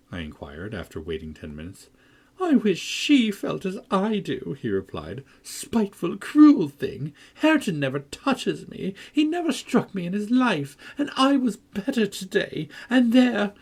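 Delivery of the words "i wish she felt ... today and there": high, whiny self-pity; whining complaint. A narrator's reading. Linton complaining about Cathy, high and whining. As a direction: whimper out the grievance. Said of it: "his voice died in a whimper".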